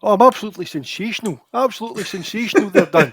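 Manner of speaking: high, quick Scottish football pundit send-up; Ali McCoist. Acted. Guest answering the host's greeting, high-pitched footballer impression. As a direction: talk as if your two front teeth are missing. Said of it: "very easy to do an Ali McCoist impression".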